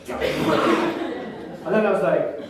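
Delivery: ordinary talk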